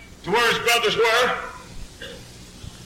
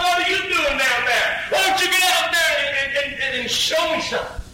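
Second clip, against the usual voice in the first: David taunting brothers; 8 semitones higher than usual. Acted. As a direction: goad them to go fight.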